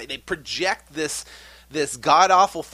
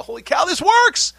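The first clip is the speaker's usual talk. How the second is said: much higher, much louder shout of delight; amazed exclamation; 10 dB louder than usual. Retold or imitated. New investor cashing his first check, shouting in amazement. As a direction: burst out in thrilled disbelief. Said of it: "and you go".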